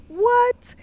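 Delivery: a sudden high, loud yelp of disbelief; shocked what. Retold or imitated. Couple learning of the sudden appointment, shrieking in disbelief. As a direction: shoot the word up in total disbelief. Reacting to the spontaneous appointment news.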